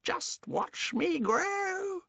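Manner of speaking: very high, slow crowing crone; boasting old hag. Acted. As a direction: crow it like a mad witch. Grandma boasting.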